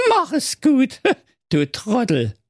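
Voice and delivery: high, pompous little king calling out; King Curry. A narrator's reading. Currywurst king calling Thorsten over on the steps, high and bossy.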